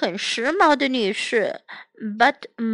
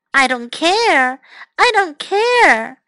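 loud, stretched-out protest; defiant yell; 5 semitones higher, 6 dB louder, slower than usual. A narrator's reading. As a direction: yell it slowly, hammering it as an upset child. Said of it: "Amanda yelled".

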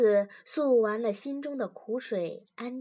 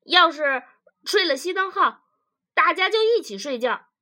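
loud, higher comeback; argumentative; 6 semitones higher, 8 dB louder than usual. Acted. Husband argues back to his wife, loud and insistent. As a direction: argue back loudly, pitched up.

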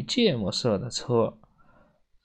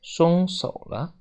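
plain reading voice

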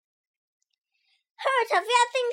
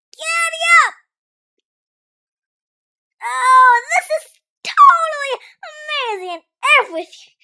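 loud, high whooping rider; excited cheering; 7 semitones higher, 8 dB louder than usual. Acted. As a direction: yell like a rodeo rider. Gremlin rides the pony.

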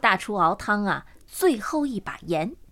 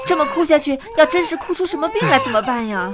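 louder, higher worried question; anxious alarm; 4 semitones higher, 5 dB louder than usual. Acted. Woman fearing the crying baby will fall ill, alarmed. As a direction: fret urgently over the crying baby.

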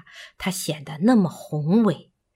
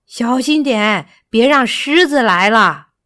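loud, raised-pitch call of caution; shouted warning; 3 semitones higher, 7 dB louder than usual. A narrator's reading. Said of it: "干巴大声喊道".

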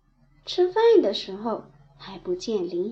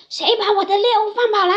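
much higher, louder, fast furious shout; stern angry demand; 10 semitones higher, 8 dB louder, much faster than usual. Acted. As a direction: bark it at them, enraged. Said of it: "他厉声喝问". (厉声喝问)

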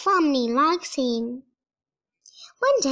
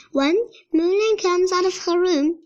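plain reading voice

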